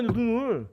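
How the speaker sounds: loud, high insistence on 눈을; emphatic. Real, not acted. Pastor insists to congregation, loud emphatic stress. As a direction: hammer 눈을 home loudly.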